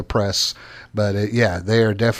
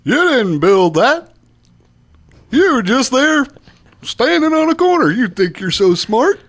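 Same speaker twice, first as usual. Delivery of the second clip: a hammed-up impression of a president mocking the audience; Bill Clinton; 12 semitones higher, 4 dB louder than usual. Mocking the president's speech to business owners, high put-on voice. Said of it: "I kinda slipped into Bill Clinton there".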